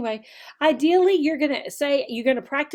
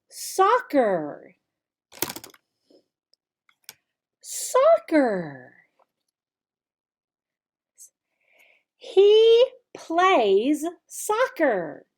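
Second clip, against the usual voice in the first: slow classroom drill voice; 4 semitones higher, much slower than usual. Shown as a demonstration. Teacher modelling he plays soccer for a child, slow drill. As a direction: stretch each word out for little learners.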